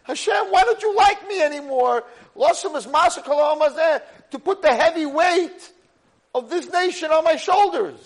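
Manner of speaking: very high, loud impassioned protest; anguished shouted plea. Retold or imitated. Moshe cries out to Hashem under the nation's weight, anguished shout. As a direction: shout to heaven under a crushing load. Acts Moshe's outburst at God.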